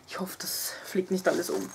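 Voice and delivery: soft unvoiced whisper. Real, not acted. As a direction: breathe it under your voice. Worried aside while packing bag.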